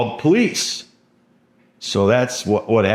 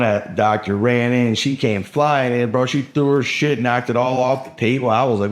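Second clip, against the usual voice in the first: usual speaking voice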